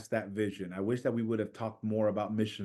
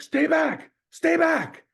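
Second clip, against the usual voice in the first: much higher, loud commanding shout; police shout; 8 dB louder, much slower than usual. Retold or imitated. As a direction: order the crowd back like riot police. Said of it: "and they're like".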